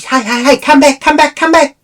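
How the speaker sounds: high, loud imitated call-back; man calling out. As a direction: act out someone shouting urgently after a person leaving. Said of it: "I hear this man's voice".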